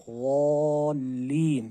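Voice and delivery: वो लीन stretched very slowly, heavy; heavy zaad, drawn out. Shown as a demonstration. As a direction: draw out "lin" in recitation. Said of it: "ज़ौद को हमने मोटा पढ़ना है".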